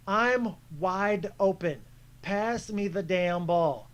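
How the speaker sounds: slow, drawn-out, deliberate demand; exasperated demand. Retold or imitated. Open player wanting the pass, slow and exasperated. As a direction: spell it out word by word, exasperated.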